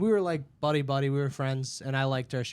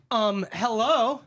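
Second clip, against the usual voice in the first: pointed, higher and louder greeting; mock indignant customer; 7 semitones higher, 5 dB louder, slower than usual. Customer demanding attention at the restaurant, mock indignant. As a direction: imitate a put-out customer, drawn out slowly.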